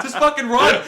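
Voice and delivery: high, loud exasperated shout; mock-frustrated holler; exasperated heckle. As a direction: yell advice at a dumb movie hero. Heckling the movie characters.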